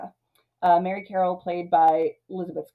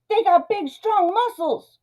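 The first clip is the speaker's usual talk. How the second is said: very high, louder mock wail; panicky Myron; 12 semitones higher, 4 dB louder, slower than usual. Myron arguing with his conscience about gangsters, high comic panic. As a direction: squeal each word like a hammed-up coward. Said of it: "Myron's like".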